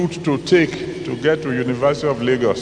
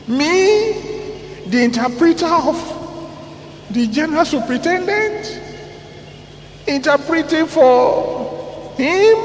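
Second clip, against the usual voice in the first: wounded pride; 12 semitones higher than usual. Retold or imitated. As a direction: scoff at the insult to your rank. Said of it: "I was angry".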